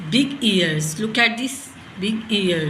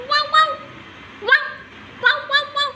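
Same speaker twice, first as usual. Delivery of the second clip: dog bark; much faster than usual. Shown as a demonstration. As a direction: yap it out like a little dog. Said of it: "Can you wow, wow like a dog".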